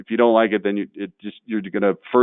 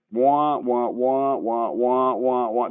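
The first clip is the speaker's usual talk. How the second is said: muffled trombone-like wah wah, droning; Charlie Brown's mother; much slower than usual. Retold or imitated. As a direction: drone like the cartoon grown-up's muted voice. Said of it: "Remember what Charlie Brown's mom sounded like?".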